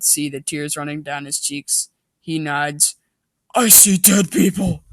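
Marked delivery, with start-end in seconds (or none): 3.5-4.8 loud, eerie movie-line delivery; spooky voice. Acted. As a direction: deliver it like a creepy ghost-seer. Spooky movie quote joke.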